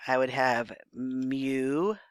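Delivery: "mu-sick" said slowly, split into two clear syllables, mu then sick. Shown as a demonstration. Teacher showing students how music splits, slow and syllabic. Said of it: "going down to music".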